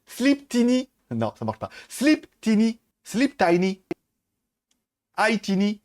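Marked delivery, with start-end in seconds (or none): 1.9-5.8 repeated French-accented command. Shown as a demonstration. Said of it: "elle comprend mon anglais de merde".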